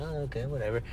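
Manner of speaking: very soft, retold shrug of a reply; indifferent mumble. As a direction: give in under your breath.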